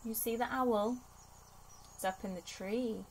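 ordinary delivery, nothing marked

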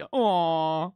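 brief cooing voice for talking to a dog. Shown as a demonstration. Owner demonstrates how they talk to a dog, cooing dog voice. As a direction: coo as if greeting a pet. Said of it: "it's like a dog voice".

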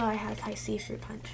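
normal talking voice

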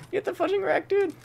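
a gleeful high-pitched jeer at the enemy; squeaky taunt. Real, not acted. Streamer taunting a downed enemy, high mocking squeak.

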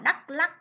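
Đắk Lắk with a crisp short ắc rhyme in both syllables; Đắk Lắk spoken as a clear model for pupils; careful pronunciation. Shown as a demonstration. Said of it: "các con chú ý cho cô vần ắc".

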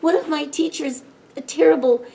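high, fast fretting; worried. A narrator's reading. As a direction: rush it out in rising worry. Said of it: "he worried".

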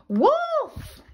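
high shout of wolf; boy crying out. Acted. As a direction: cry the alarm. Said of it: "A boy cried".

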